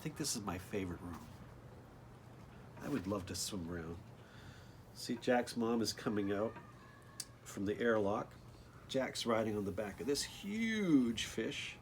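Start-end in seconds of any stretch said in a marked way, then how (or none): none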